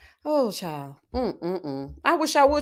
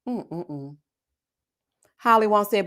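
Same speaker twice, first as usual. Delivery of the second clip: usual speaking voice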